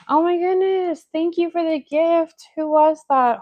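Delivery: high, loud excited thanks; thrilled gasp of gratitude. Real, not acted. Surprise thank-you for a live gift.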